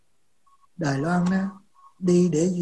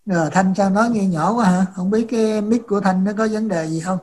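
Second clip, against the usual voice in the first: speaking up; 8 dB louder than usual. Real, not acted. Talking over a faulty mic.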